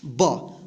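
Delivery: ब dropped low, short; B sound. Shown as a demonstration. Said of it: "क्या आता है?". (ब को नीचे स्वर में छोटा बोलें)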